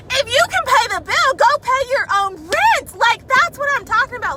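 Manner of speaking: high, loud, indignant comeback; venting about the man living in her house; angry retort. Real, not acted. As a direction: snap back with outrage, slowing on the rent line.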